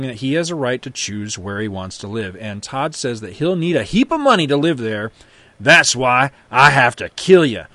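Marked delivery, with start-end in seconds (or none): 5.6-7.6 louder, deliberate cowboy threat; threatening. Acted. Todd turns his gun on father, threatening. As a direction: lay down a grim verdict.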